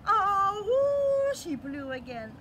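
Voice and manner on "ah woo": loud, high whooping imitation of a conch horn; loud conch blow. Blowing the conch shell.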